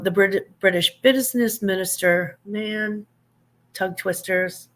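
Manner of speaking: normal talking voice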